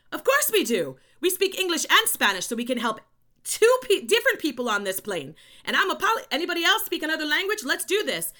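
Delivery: loud, high, excited call to fellow passengers; rally everyone with big, excited energy; retold burst of eager volunteering. Retold eager answer to flight attendant.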